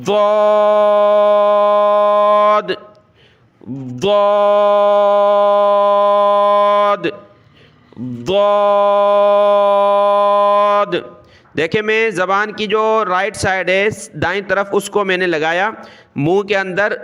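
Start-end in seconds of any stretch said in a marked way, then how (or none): 0.1-11.0 ضاد drawn out with the side of the tongue pressed up, a little louder; long heavy ضاد; tongue-side zwaad. Shown as a demonstration. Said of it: "اور اس کو ہم نے پڑھنا ہے".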